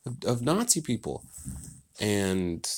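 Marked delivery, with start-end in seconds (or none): 0.1-1.2 mock crowd. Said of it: "So everyone's like".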